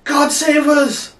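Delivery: slow, high-pitched shouted plea; panicked cry. Acted. Another sailor begs God to save them, panicked cry. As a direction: call out loudly, stretching the words in alarm. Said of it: "cried another one".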